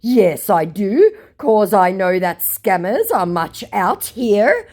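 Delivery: high, loud, very slow put-on voice; earnest scammer. Scammer answers her doubts, slow mock-earnest voice. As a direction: declaim it slowly in mock earnest. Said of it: "To which he says".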